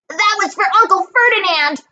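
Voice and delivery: high-pitched triumphant yell; high, defiant battle cry; yelling back. A narrator's reading. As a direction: holler it high at the monster. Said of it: "Grover yelled back".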